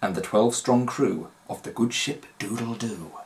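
ordinary delivery

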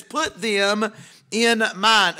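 them said slow and weighty; stress on them. Shown as a demonstration. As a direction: lean hard on them, slowly. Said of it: "I'm stressing that word, them".

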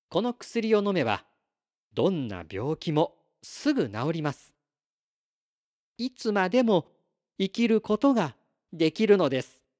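やや高めの声で、言い切るように; 薬の効き目を請け合う星の子を軽く演じる; 薬を説明する星の子. Acted. 星の子が薬の効き目を説く. (自信をもって説く星の子の声)

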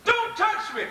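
"Don't touch me" jumps high, urgent; urgent warning. Acted. Jesus stopping Mary from grasping him, urgent high warning. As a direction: pitch it up as a sharp urgent warning.

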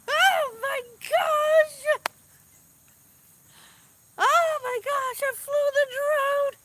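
high, slow, drawn-out amazement; amazed elation. Real, not acted. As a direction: marvel at your own success. Amazed at her own success.

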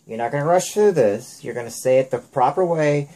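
sharp telling-off, then laying down the law; stern scolding. Real, not acted. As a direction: stop her in her tracks, firmly. Parent stops child rushing answers.

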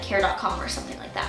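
ordinary talk

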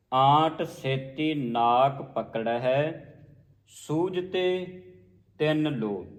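slow, rising in pitch toward the end; measured scripture recitation. Shown as a demonstration. Teacher modelling the full line, slow measured recitation. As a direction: recite phrase by phrase.

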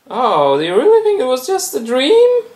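very high, fast taunting voice; creepy mocking toy. Fidget spinner mocking the dreamer, very high and fast. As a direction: sneer in a squeaky high voice.

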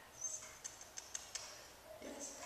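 usual speaking voice

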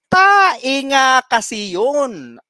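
very slow, drawn-out "Tae nga kasi yun"; slow punchline. Acted. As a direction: stretch every word of the punchline. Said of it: "drawn-out punchline". (mabagal at pahabang biro)

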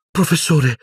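high, strained cry of alarm; a boy shouts for his fallen teacher in alarm; tense exclamation. A narrator's reading. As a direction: call out to him, voice tight with fear. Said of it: "esclamò Harry, la voce tesa".

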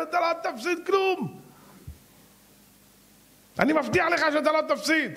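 high, shouted assurance; emphatic promise. Acted. Rabbi promises loudly.

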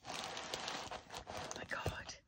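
breathy awed whisper. Real, not acted. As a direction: whisper it in awe. Quiet awe at the design.

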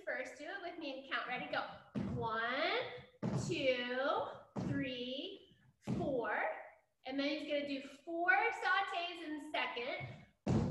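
soft, quiet dance instructions; dance teacher counting. Acted. Teacher counts the steps for the class, softly. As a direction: guide the children through the steps gently.

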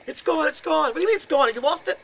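high, loud frantic back-and-forth; acted panic. Two roommates losing track of the apparition, high-pitched panic. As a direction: blurt it out in alarm.

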